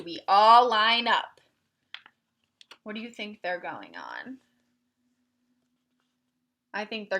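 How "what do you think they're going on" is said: ordinary talk, nothing marked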